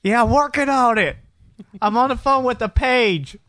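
high, slow whiny excuse voice; mocking friend's voice. Mimicking friend's phone excuses.

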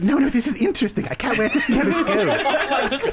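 very high, laughing delight; playful Delilah. Acted. Delilah shushing her friend, playfully delighted. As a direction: squeal it playfully. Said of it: "playfully, like, pushes her".

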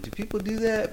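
high, soft, disbelieving squeak; mock incredulous. Retelling his reaction to the roll call, mock incredulous. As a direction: play up the surprise at the idea. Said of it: "I'm like".

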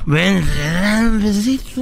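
put-on slow, wheedling drunk coax; mock drunk guy. Drunk boyfriend coaxing for a kiss, slow. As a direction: drawl it out slowly like a drunk. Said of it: "Y el vato así".

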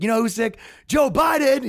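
high, loud imitated accusation; mock rally shout. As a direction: build to a shouted name as the punchline. Retelling Trump's punchline at rally.

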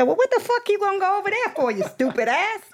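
shrill-pitched mock outrage; mock scold. The woman scolding her for going to see her ex, in a high put-on voice.

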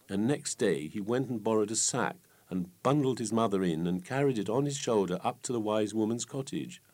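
plain reading voice

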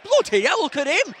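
high, loud amazed exclamation; astonished. Pretend. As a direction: gawp aloud at the huge player. Amazed at the big player's size.

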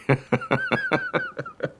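a burst of high laughter. Acted. Laughing at Haven's worry.

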